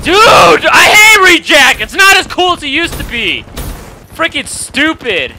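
very loud, high frustrated rant; furious shouting. Real, not acted. Player fed up with the game, furious shouting. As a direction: yell it out, easing slightly by the end.

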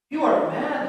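ordinary delivery, nothing marked

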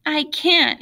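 understated tearful protest; sobbing. A narrator's reading. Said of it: "he sobbed".

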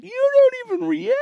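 squeaky wife impression teasing him; imitating wife. Husband mimics wife teasing that he never reacts. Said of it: "She's like".

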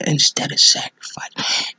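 unvoiced whisper; hushed aside. Real, not acted. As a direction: breathe the words. Speaker drops into whisper.

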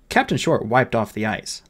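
usual speaking voice

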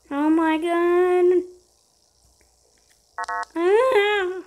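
slow, drawn-out gasp of dread; stretched-out frightened cry; terrified horror victim. Real, not acted. Fright during gameplay.

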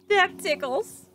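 high, giggling words; giggly tickled voice. A narrator's reading. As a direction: laugh through the tickling. Pig tickles Leroy.

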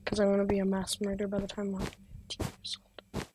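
soft unvoiced sinister joke; whisper. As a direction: whisper it like a dark secret.

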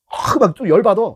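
hammed-up annoyed driver; mock anger. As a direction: act out getting heated. Voicing rookie's anger.

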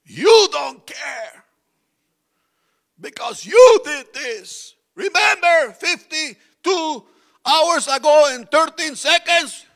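high, slow, shouted reproach; loud accusing shout. Pretend. Arguing spouse dredging up the past, loud accusing shout. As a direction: throw the old grudge back at them, slowly. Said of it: "You start defending yourself".

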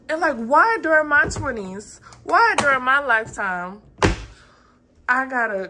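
loud, high, fed-up why; exasperated complaint. Real, not acted. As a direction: throw up your hands and complain loudly.

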